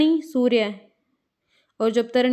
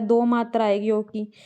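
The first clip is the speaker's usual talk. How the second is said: ordinary talk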